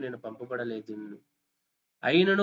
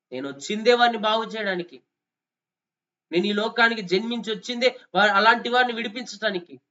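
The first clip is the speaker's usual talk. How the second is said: higher, louder impassioned declaration; full-force preaching; 6 semitones higher, 5 dB louder than usual. Acted. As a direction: proclaim it like a fiery preacher. Preacher voicing Jesus forcefully.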